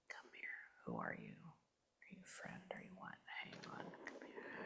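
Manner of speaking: barely audible, breath-only whisper; whispered questioning. Real, not acted. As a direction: whisper questions to an unseen spirit. Murmuring questions to the spirit.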